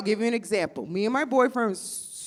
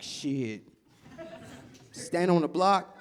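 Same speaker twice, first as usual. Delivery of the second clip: much lower, quieter deadpan man; boyfriend voice; 5 semitones lower, 5 dB quieter, slower than usual. Retold or imitated. Comic voicing her boyfriend answering a silly question. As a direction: drop it low and matter-of-fact.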